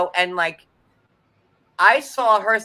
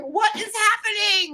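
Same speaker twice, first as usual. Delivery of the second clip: much higher, baffled cry; stunned disbelief. Retold or imitated. Viewer reacting to the fight, much higher and stunned. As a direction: gasp it out in disbelief. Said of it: "I was like".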